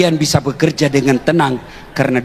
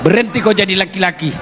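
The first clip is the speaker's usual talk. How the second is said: preaching at full force; 11 semitones higher than usual. Acted. Ustadz fires up the congregation about wives and husbands, at full force. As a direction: preach it hard to the crowd, rising. Preacher rises to fervent pitch.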